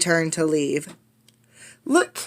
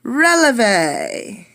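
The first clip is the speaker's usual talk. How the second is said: ballet teacher call; 5 semitones higher than usual. Acted. Ballet teacher calling steps to a class. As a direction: call the step like a ballet mistress.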